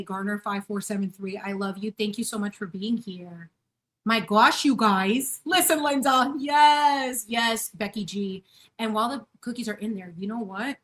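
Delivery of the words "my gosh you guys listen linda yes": loud, high shout to a friend; excited callout. Real, not acted. Calling out viewer Linda in chat, loud excited shout. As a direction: call her name out across the room.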